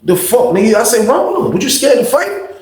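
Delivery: incredulous pitch climbing high; retold goading. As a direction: egg him on in an acted-out retelling. Acted goading to fight.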